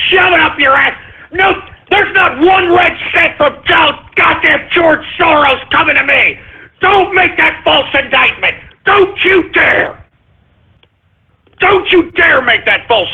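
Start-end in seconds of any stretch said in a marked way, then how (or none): 0.1-8.5 high, angry shouting at the accusers; furious shout. Real, not acted. Host furious at Soros accusation. 8.8-10.0 slow, high shouted warning, each word hammered; furious warning shout. Real, not acted. Host furious at Soros accusation.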